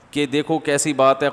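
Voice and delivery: ordinary talk, nothing marked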